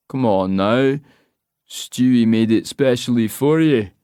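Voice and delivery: slow, loud pushy local; Scottish pub man. Acted. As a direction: lean in and drawl the question. Man pushes the drink.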